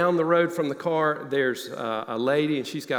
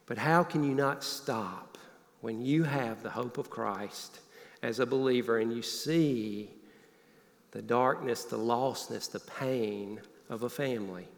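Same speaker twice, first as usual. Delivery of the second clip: slow, much softer, drawn-out musing; hushed reflection; 3 semitones lower, 6 dB quieter than usual. Real, not acted. Preacher quietly asking the congregation, hushed and slow. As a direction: let the voice sink as you ponder the lostness.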